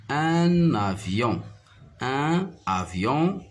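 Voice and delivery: "un avion" said slowly with a linking n, "un-n-avion"; liaison in un avion. Shown as a demonstration. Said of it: "et on fait la liaison".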